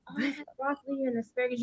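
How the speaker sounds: fast, quiet put-on voice; mock texter listing her dinner, quick and soft; mock dinner report. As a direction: rattle off a bored dinner report. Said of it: "I don't wanna".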